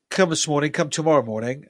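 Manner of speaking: normal talking voice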